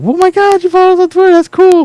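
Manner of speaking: mock gushing. Mocking a fan thrilled at a follow, high gush. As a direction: squeal like a starstruck fan. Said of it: "I was like".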